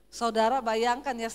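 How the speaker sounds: normal talking voice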